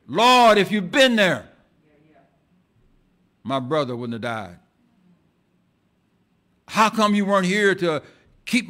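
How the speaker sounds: grieving sister confronts Jesus with loud blame; blurted anguished reproach. Acted. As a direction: hurl the hurt and blame at Jesus. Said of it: "she blurted".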